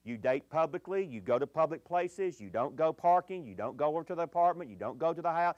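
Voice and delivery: ordinary talk, nothing marked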